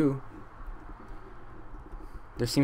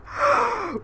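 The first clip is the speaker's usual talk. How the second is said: high mock gasp. Shocked gasp after betrayal.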